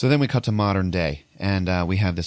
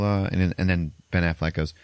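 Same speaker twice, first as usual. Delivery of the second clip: ordinary talk, nothing marked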